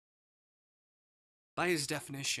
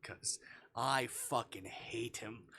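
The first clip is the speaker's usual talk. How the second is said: ordinary delivery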